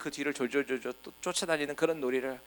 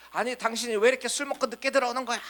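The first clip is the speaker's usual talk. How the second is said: high, fast mock scolding of a late, drunk husband; child playing scolding mom; 9 semitones higher, faster than usual. Child in house play scolds her pretend husband, high and fast. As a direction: play the little girl pretending to be an angry wife. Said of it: "막 그러면서 막 야단치기도 하지요".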